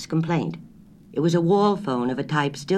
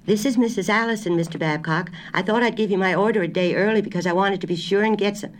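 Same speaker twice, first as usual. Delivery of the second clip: higher-pitched, carrying telephone manner; raised pitch for a phone order; projected phone-call voice; 7 semitones higher than usual. A narrator's reading. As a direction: make yourself understood on a bad line. Phone order to distant grocer.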